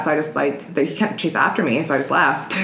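ordinary talk

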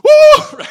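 very high, loud whoop of delight; acted joyful shriek; gleeful whoop. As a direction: let out a piercing woo like a lucky beggar. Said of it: "the beggar kind of like".

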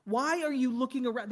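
high-pitched, indignant questioning; loud and high, easing slightly; exasperated God. Acted. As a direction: demand an answer as an exasperated God. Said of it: "That's what God says".

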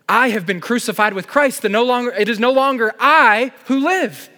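preacher proclaiming Galatians with passion; fervent proclamation. Acted. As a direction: proclaim it with rising fervor.

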